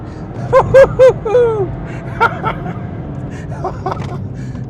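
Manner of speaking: long high delighted laughing fit; giddy laughter. Real, not acted. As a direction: laugh hard with pure thrill. Long laugh after drift.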